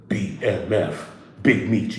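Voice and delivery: slow, low impression; Big Meech. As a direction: draw it out deep like the man himself.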